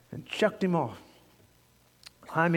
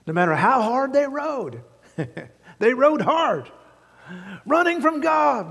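high, loud revival preaching; shouting preacher pressing the congregation; fiery preaching; 9 semitones higher, 6 dB louder than usual. Acted. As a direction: thunder it from the pulpit. Preaching at full force.

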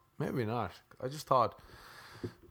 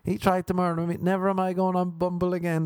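upset woman to friends; 8 semitones higher, faster than usual. Retold or imitated. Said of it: "going back to their friends, be like".

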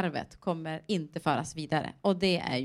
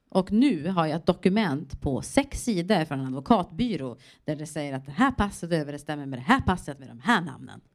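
ordinary talk, nothing marked

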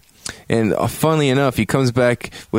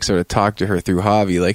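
ordinary delivery, nothing marked

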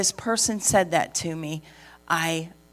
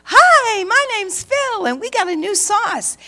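much higher, loud, bouncy greeting; happy skipping manager; 7 dB louder than usual. Retold or imitated. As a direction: chirp it out like a giddy restaurant manager. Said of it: "he was so happy. And he's come skipping around".

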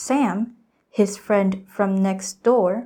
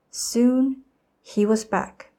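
plain reading voice

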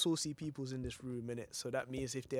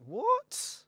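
surprised; 3 dB louder than usual. Real, not acted. Shocked reply to Bashment remark.

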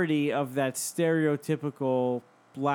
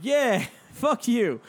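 higher, louder, slow and laughing; laughing retort; 10 semitones higher, 6 dB louder, much slower than usual. Retold or imitated. Narrator mocking the man, laughing loud. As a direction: laugh through a mock jeer. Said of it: "And I was like".

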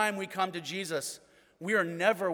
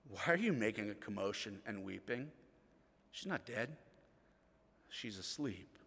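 soft, calm rebuke; 8 semitones lower, 8 dB quieter than usual. Retold or imitated. Jesus speaks softly to the mourners.

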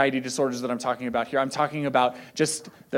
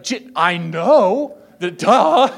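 sarcastic duh; 3 semitones higher, 7 dB louder, much slower than usual. Retold or imitated. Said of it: "And it's like".

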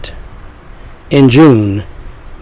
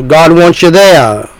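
ordinary delivery

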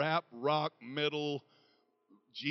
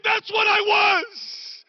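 shouted quote; 17 dB louder, much slower than usual. Retold or imitated. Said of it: "She said".

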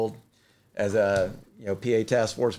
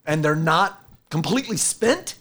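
higher, louder astonished quote; amazed firefighter; 7 semitones higher, 4 dB louder than usual. Retold or imitated. Firefighter watching the demo, higher louder mock astonishment. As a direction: sound stunned at what you just saw. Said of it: "they're like".

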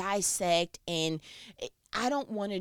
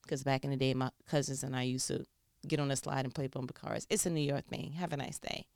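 lower, softer, quick throwaway aside; 8 semitones lower, 6 dB quieter, faster than usual. Real, not acted. Explaining a childhood New York thing to listeners, low quick aside. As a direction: toss it off quietly and fast, an octave down.

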